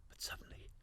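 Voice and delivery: barely a breath; whisper. Acted. Narrator whispering as the sound begins. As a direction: whisper the turn in suspense. Tension building in the dark.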